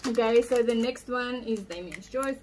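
talking with mouth partly full; laughing while chewing; chewing candy. Real, not acted. Seen 0: Opened wrapper in hand, hand at mouth, closed working mouth.

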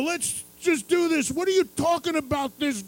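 furious hammed-up Zach; enraged rocker. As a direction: rage at the lame music. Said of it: "he was, like, enraged".

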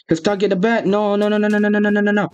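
high, rapid string of panicked cries; frantic high-pitched alarm. Real, not acted. Panics as game attack begins.

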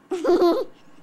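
loud burst of giggling; loud giggle. Real, not acted. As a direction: giggle loudly after the greeting. Sister laughs.

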